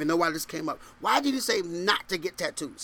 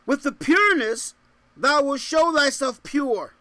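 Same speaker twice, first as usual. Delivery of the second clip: raised, ringing verse reading; scripture proclamation; 7 semitones higher, 6 dB louder than usual. Real, not acted. Preacher reads scripture to congregation, proclaiming it. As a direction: proclaim the verse high and strong.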